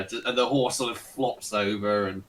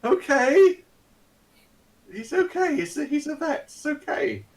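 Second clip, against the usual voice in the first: very high, sing-song relief; high silly bystander voice; passers-by calming down, high silly relief; reassured bystanders; 3 dB louder than usual. Acted. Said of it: "They're like".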